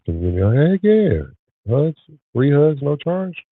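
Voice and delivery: high, loud hype, pitch jumping up; rally the chat for a heck yeah; playful shout for free hugs; excited call-out. Real, not acted.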